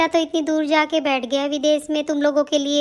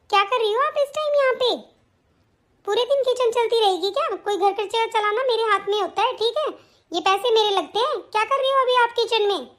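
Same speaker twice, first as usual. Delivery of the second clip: higher, sharp scolding voice of a bossy bahu; scolding daughter-in-law; 5 semitones higher than usual. Acted. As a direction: nag her mother-in-law from above.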